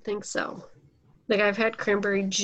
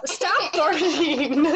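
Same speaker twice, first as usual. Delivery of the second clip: drawn-out, higher-pitched scold; real scolding; 6 semitones higher, much slower than usual. Real, not acted. Host telling the dog to stop barking, scolding.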